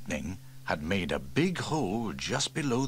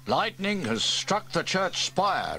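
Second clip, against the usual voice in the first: slow, higher, grave chief voice; 10 semitones higher, 3 dB louder, slower than usual. Acted. Fire chief tells James about the fire, gravely. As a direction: announce the danger gravely. Steele reports.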